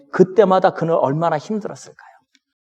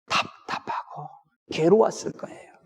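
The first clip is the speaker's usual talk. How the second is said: whisper; 5 dB quieter than usual. Real, not acted. Preacher empathizing with Elijah's anguish.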